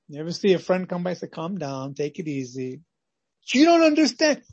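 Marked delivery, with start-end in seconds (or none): 1.3-2.8 softer, low-voiced calming; a friend easing a panicked winner, soft and low; soothing friend. 3.5-4.4 high, loud, bursting with news; can barely contain it; lottery winner blurting out his news, high and loud; overexcited. Acted.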